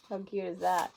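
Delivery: plain reading voice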